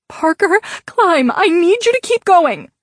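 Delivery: high, loud desperate yell; shouting. A narrator's reading. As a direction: scream at him to climb. Screaming at him to climb.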